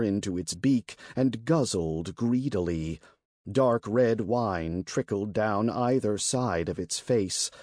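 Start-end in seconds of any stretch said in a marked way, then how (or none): none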